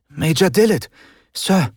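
put-on high, bright boyish salute; excited young soldier's greeting; eager boy. A narrator's reading. Boy greets officer happily.

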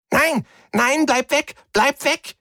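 very high squeaky cartoon-like voice; nervous little Mombri piping at Rick to stay away. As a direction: squeak out the pleas in alien panic. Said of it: "piepte er auf Staga".

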